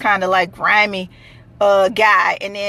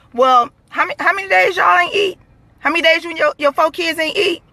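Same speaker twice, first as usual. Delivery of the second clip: incredulous outburst; 7 semitones higher than usual. Retold or imitated. Confronting beggar about days unfed.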